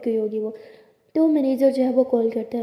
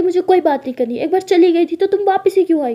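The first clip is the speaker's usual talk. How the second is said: loud, angry refusal; shouting; 5 semitones higher, 7 dB louder than usual. Acted. Maya refuses to talk with her returned partner, shouting angrily. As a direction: shut the conversation down, voice raised. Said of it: "हाँ तुम चिल्ला रही हो".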